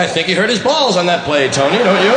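mock play-by-play announcer; Kurt Gowdy. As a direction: comment on an injury to the co-commentator. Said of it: "But he can't say".

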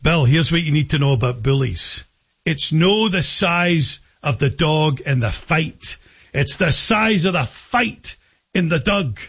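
put-on a dad's Scottish lilt on the old saying; Scottish accent. Father giving his daughter advice in a light Scottish accent. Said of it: "He used to say with his Scottish accent".

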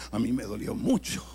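usual speaking voice

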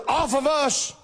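ordinary talk

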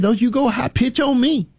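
high, mock-scolding old lady. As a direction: imitate Miss Betty scolding him. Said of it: "She said".

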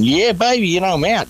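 high, whooping mock celebration; mock cheer. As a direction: crow it like you've just got free. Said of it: "I'm gonna be going".

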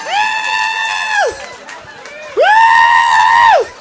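very high drawn-out woo; squealed club cheer; party-girl whoops. Acted.

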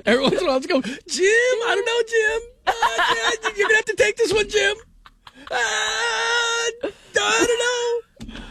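very high, whiny, half-sobbing voice; mock Tony Romo. Host parodying Tony Romo begging his partner Jim, whining. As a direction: whimper helplessly for Jim.

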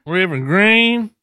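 Hillary. Retold or imitated. Co-host echoes the candidate, fake Southern accent. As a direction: echo the fake church twang. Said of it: "Hillary doesn't sound like that".

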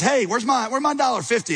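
mock demand, pitched high; hammed-up indignant creditor. Forgiven man confronting his buddy, mock angry demand. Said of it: "goes over to this other guy and says".

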